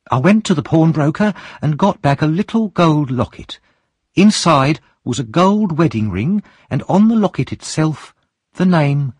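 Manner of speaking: high woman's voice; calm, unafraid woman; Mrs Bumble. Acted. Mrs Bumble finishes her tale.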